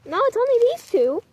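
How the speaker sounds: high cooing pet voice. Real, not acted. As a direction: chirp it up high. Talking about the kittens.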